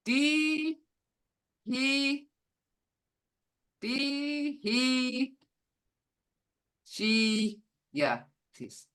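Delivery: each syllable said apart. Shown as a demonstration. Teacher sounding out a word for the class, syllable by syllable. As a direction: sound it out slowly, piece by piece. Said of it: "Those are all in those sounds".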